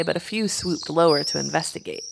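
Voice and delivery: plain reading voice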